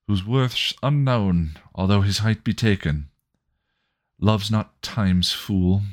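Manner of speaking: high, lilting sonnet recital; poem recital. Acted.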